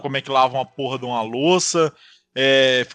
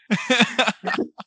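risada; 8 semitones higher than usual. Real, not acted. Riso da história.

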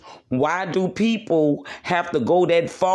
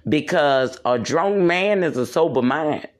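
usual speaking voice

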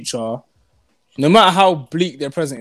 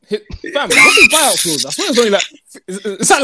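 excited; 7 semitones higher than usual. Real, not acted. Excited transfer debate.

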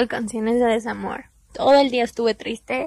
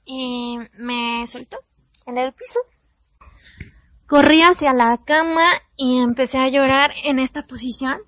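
normal talking voice

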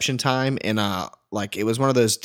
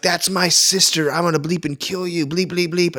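angry brother; 6 semitones higher than usual. Retold or imitated. Imitating the bride's drunk brother threatening him, in mock fury. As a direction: ham up a furious big brother's threat. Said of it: "and he's like".

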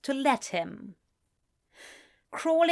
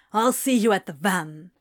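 brusque; 2 semitones lower, 4 dB louder than usual. A narrator's reading. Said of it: "Crowley said brusquely".

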